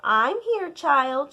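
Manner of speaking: plain reading voice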